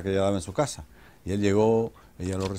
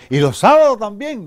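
put-on high, loud eager girl; niña mayor; 10 semitones higher, 9 dB louder than usual. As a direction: pipe up high as an excited daughter. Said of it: "dice la niña mayor". (niña entusiasmada, voz aguda y fuerte)

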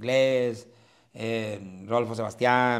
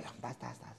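ordinary talk, nothing marked

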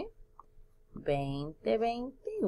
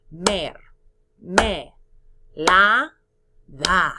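"Mer, me, la, da" louder, each syllable alone; counting syllables; 9 dB louder, much slower than usual. Shown as a demonstration. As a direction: clap each beat of "Mer, me, la, da". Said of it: "Vamos a contar sílabas en mermelada".

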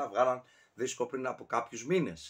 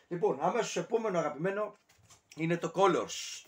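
ordinary talk, nothing marked

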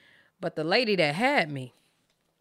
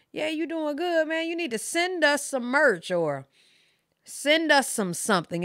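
mother asking for merch; 8 semitones higher than usual. Retold or imitated.

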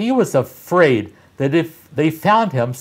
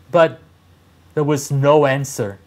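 ordinary delivery

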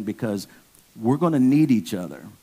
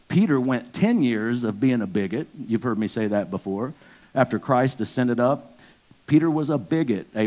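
ordinary delivery

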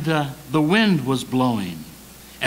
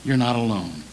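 low reassurance fading softer; tender, lowered divine voice; gentle Lord; 8 semitones lower, 4 dB quieter, faster than usual. Acted. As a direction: comfort gently, then repeat it more hushed. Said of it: "The Lord is gentle".

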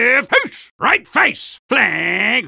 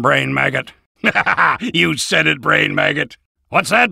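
ordinary talk, nothing marked

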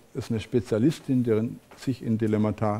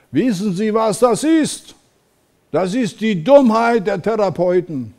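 put-on much higher, louder scolding Italian accent; Mara Selvini Palazzoli, Italian accent; 9 semitones higher, 7 dB louder than usual. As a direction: lecture the room in a strong Italian accent. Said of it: "spricht mit italienischem Akzent und sagt".

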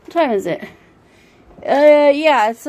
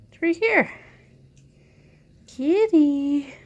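pet talk; 5 semitones higher than usual. Real, not acted. Owner teasing her cat with a treat, high baby talk. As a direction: coo at the kitty in a high voice.

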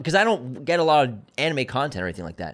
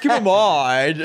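laughing mimicry; 6 semitones higher, 6 dB louder, much slower than usual. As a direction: echo keep in mind through laughter. Mocking the Wiseau line.

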